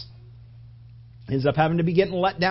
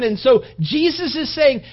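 higher and louder, fervent sermon build; preaching at full force; 7 semitones higher, 8 dB louder than usual. Acted. Pastor pressing the cross on the congregation, fervent preaching. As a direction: preach it out, pleading with the room.

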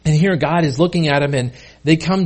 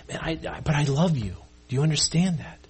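hushed, softer appeal; tender reassurance; 2 semitones lower, 7 dB quieter than usual. Retold or imitated. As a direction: drop the volume and reassure tenderly. God's tender words to believers.

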